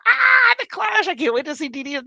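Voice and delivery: excited shout. Real, not acted. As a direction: cry it out, thrilled. Excited about meeting at event.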